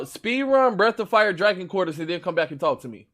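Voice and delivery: ordinary delivery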